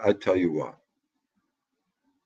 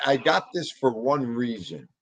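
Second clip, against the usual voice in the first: ordinary talk, nothing marked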